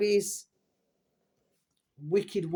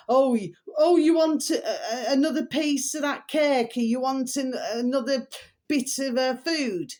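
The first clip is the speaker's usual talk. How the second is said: mocking aunt; 6 semitones higher than usual. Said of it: "Since when should anybody say to a young bairn".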